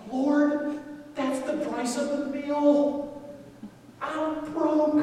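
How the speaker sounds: high, drawn-out comic whine; mock pleading. Broke man protesting to the Lord, whiny mock plea. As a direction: plead it high and slow, hammed-up. Acts pleading with God over money.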